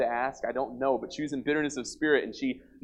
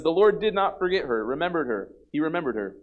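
raised, higher reading voice for the verses; scripture reading; 4 semitones higher, 5 dB louder than usual. Acted. Preacher reading the Bible passage aloud, raised and proclaiming.

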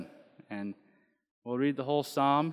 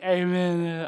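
imitated singers' twang, drawn out and higher; twangy amen; 4 semitones higher than usual. Mimicking twangy singers for the congregation, exaggerated twang. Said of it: "they are the twangiest people on the entire planet".

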